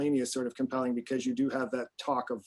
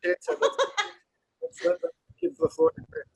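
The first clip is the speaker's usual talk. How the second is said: very high, breathless giggling line; laughing through words. Real, not acted. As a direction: try to speak while cracking up. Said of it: "Say that again".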